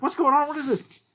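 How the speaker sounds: put-on high, confused kid voice; a high, startled young boy; bewildered cartoon boy. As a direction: yelp it like a boy who just got hurt. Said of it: "And he's like".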